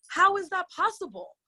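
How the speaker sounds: high-pitched, incredulous question; indignant disbelief. Real, not acted. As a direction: demand an explanation in disbelief.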